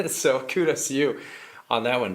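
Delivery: ordinary talk, nothing marked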